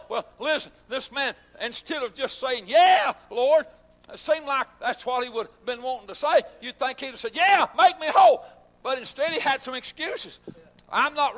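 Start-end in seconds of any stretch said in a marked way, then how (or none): 2.7-3.7 loud, eager yes; eager reply. Retold or imitated. Preacher voicing the man's eager answer, loud. 7.3-8.4 higher, loud eager plea. Retold or imitated. Preacher voicing what the man should have said, loud.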